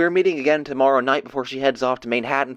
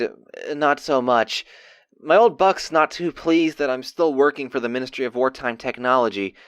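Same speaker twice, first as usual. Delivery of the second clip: ordinary delivery, nothing marked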